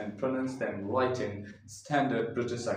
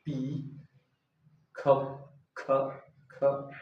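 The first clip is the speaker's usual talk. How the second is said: ordinary talk, nothing marked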